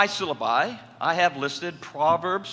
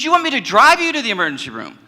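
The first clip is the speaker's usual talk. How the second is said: louder, quick, pressing; urgent offer; 6 semitones higher, 9 dB louder, faster than usual. Retold or imitated. Therapist urging his patient toward the emergency room, louder and quick. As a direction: push him to accept help now.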